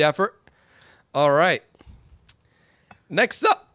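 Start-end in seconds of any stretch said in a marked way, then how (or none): 3.1-3.7 higher, louder call that cracks; cracking announcer call. Real, not acted. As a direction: let the voice break on Next up. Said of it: "I almost cracked myself".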